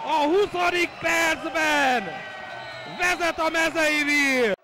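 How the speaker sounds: loud, slow goal roar; excited Hungarian sports commentary. Acted. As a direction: bellow the goal, drawing it out.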